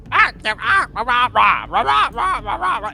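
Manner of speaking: high, loud yappy kobold babble; kobold gibberish. Acted. The blue kobold greets the party in loud high babble. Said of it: "he begins saying a bunch of stuff".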